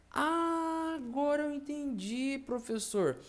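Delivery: high student voice, easing down; teacher plays the student's sudden understanding; mock student. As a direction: act the pupil finally getting it.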